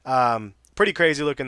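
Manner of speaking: ordinary talk, nothing marked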